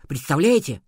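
plain reading voice

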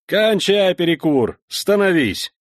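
loud slow barked order; shouted command. Acted. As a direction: yell it across the parade ground. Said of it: "крикнул в глубину плаца".

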